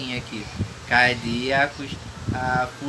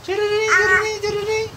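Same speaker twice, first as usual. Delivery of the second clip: much higher sung ringing noise; phone ring imitation; 6 dB louder, faster than usual. Father imitating the phone ringing for the baby. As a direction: trill it like a toy phone.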